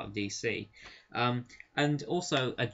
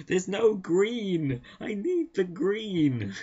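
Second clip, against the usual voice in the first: higher, slow pleading craving; mock desperate addict; 7 semitones higher, 3 dB louder, slower than usual. Mocking Green Lantern as a craving addict, high slow pleading. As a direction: beg for it like a junkie. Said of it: "sounds like a green drug addict".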